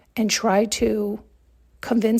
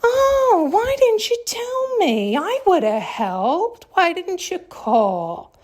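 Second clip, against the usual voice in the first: high, slow, whiny mock concern; mocking fake sympathy; 9 semitones higher, slower than usual. Mocking people who return once you're winning, whiny. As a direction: parody their fake regret, drawn out. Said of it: "come back around when you're winning and say".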